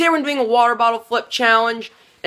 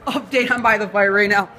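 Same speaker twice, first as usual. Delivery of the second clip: ordinary talk, nothing marked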